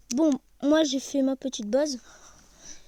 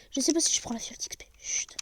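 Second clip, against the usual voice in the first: fast whispered joke; secret whisper. Pretend. Gamer whispering a joke to viewers, hushed. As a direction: whisper it like a secret, then shush.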